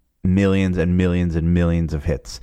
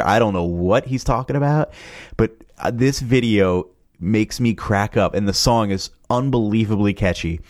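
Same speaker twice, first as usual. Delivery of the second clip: ordinary talk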